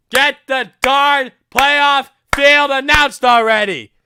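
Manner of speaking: loud and slow, exasperated; frustrated demand. Real, not acted. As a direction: demand it, fed up.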